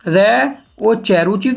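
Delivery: र, ओ, च spelled letter by letter, then रुचि said whole; slow letter-by-letter spelling of रुचि; spelled out slowly. Shown as a demonstration. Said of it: "और रुचि देखिए".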